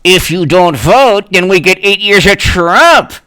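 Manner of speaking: high, slowing, accusing whine; mock accusing supporter. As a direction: needle him like an online zealot.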